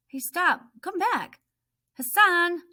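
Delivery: high, loud calls; calling after someone. Acted. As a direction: yell after him to stop. Chasing and calling after Hassan.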